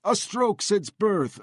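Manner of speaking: put-on high, pleading foreign accent; heavily accented English, slow and pleading. A narrator's reading. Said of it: "heavily accented, broken English".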